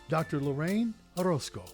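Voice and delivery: ordinary talk, nothing marked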